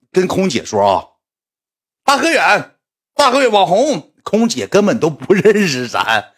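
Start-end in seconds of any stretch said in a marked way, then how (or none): 2.0-4.1 high, slow call of the name; high excited fan voice. Retold or imitated. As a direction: mimic a fan shouting a name. Imitating the fan calling out.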